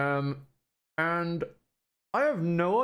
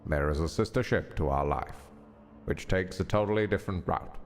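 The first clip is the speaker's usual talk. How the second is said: low, measured epigraph reading; solemn reading aloud; 9 semitones lower, 3 dB quieter than usual. Acted. Streamer reading a game's opening text, solemn low reading. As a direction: read it low and grave, with pauses.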